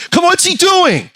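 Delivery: high, loud disbelief; frantic fan. Retold or imitated. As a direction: shout at the screen. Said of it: "He goes".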